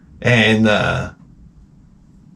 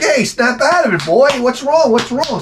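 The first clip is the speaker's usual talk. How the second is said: high-pitched, hammed-up alarm; urgent questions in a much higher voice; panicky pleading; 12 semitones higher than usual. Spooked owner to his entranced dog, hammed-up panic.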